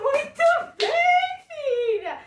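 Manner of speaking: high, loud, drawn-out well done; proud singsong praise, stretched out; delighted praise. Real, not acted. Praising her small daughter.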